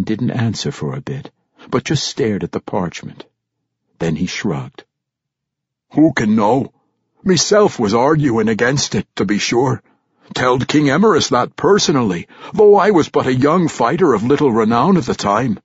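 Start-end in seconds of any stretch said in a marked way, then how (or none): 5.9-15.6 loud, high gruff dwarf scoff; gruff old dwarf warrior. Acted. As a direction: scoff like a crusty old veteran. Old warrior Ragged Dane voiced.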